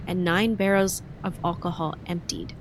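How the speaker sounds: normal talking voice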